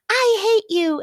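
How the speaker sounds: very high mock child outburst; hammed-up tantrum from a small child; angry little kid. Acts child's reply to refusal.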